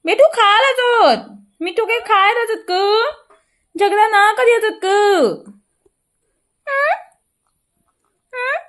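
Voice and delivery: very high, slow cooing; slow high sing-song coaxing the parrot to eat. Real, not acted. Slow baby talk to birds.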